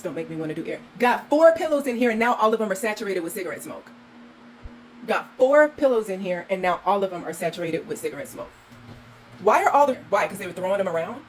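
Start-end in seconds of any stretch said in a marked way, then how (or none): 1.0-8.5 much louder, higher exasperated rant; loud angry rant. Real, not acted. Host showing viewers smoke-ruined pillows, loud angry rant. 9.4-10.3 high, loud exasperated cry; outraged shout. Real, not acted. Host finding pillows thrown around, outraged loud cry.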